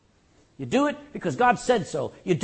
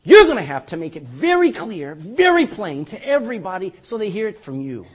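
loud, laughing appeal; loud plea to God; 8 dB louder than usual. Retold or imitated. Pastor retelling his plea to God, loud and laughing. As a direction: loudly plead half laughing. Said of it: "and I say".

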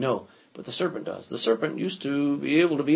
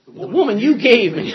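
whiny, higher, louder blame-shifting; mocking excuse; 7 semitones higher, 9 dB louder than usual. Teacher mocks Adam blaming God, in a whiny, high voice. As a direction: throw the blame back, mockingly.

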